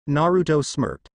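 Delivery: plain reading voice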